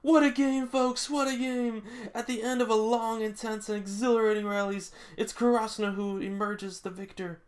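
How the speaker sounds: high, loud hyped announcer call; sports announcer. Acted. Announcer declaring Karasuno the winner, hyped and high. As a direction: sell the win to the whole crowd.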